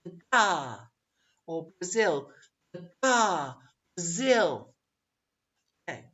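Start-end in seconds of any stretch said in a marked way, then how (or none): 0.0-0.8 "the ga" with a loud "ga"; weak "the", long falling "ga"; second-syllable stress. Shown as a demonstration. Teacher beating out the pattern, stress on the end. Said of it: "The same goes for". 1.8-4.7 "Brazil" with a long, pushed "ZIL"; weak first beat, stressed end in "Brazil"; second-syllable stress. Shown as a demonstration. Teacher modelling the pattern, final syllable stressed. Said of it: "The same goes for".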